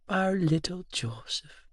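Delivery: put-on high, soft, slow old woman; frail tenderness to a great-grandson; hushed, drawn-out and tearfully fond; emotional great-grandmother. Tearful great-grandmother greets him.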